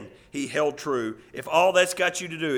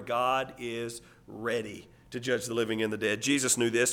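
normal talking voice

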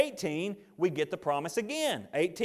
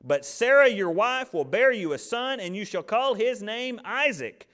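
loud, raised-pitch firm pronouncement; emphatic; 4 semitones higher, 4 dB louder than usual. Acted. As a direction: read God's answer with emphatic, punched force. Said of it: "look how emphatic he is".

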